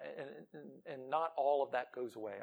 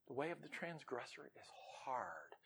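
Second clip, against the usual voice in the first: soft, hushed whisper; 3 semitones lower, 14 dB quieter than usual. Acted. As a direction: drop to a hush for the verse. Hushed scripture line.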